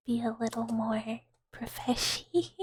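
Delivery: ordinary delivery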